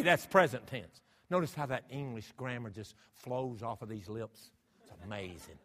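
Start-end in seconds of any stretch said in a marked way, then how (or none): none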